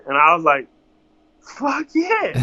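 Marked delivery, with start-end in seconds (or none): 1.4-2.4 jumps way up high in delight; thrilled yes. Retold or imitated. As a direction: blurt it out, overjoyed.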